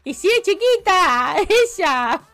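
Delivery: high loud laughing gush; laughing fondly. Real, not acted. Streamer laughs and gushes over a young viewer. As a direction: laugh through it, gushing over her.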